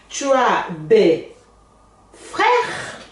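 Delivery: "des frères" with a silent s, no liaison; no s before "frères". Shown as a demonstration. Teacher shows "des" stays silent before a consonant. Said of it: "não falo aqui também não".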